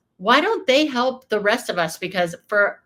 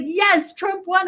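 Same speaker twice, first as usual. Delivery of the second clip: higher, louder, beaming yes; delighted nurse; 10 semitones higher, 3 dB louder, slower than usual. Retold or imitated. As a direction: imitate a thrilled man sharing big news. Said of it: "He's like".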